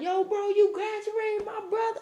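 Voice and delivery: excited friend. Retold or imitated. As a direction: yell it like an overjoyed buddy. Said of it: "He like".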